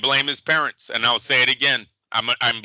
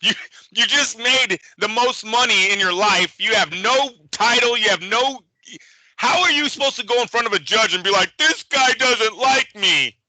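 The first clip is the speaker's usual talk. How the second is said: stern lawyer; 7 semitones higher than usual. Retold or imitated. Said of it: "they probably said".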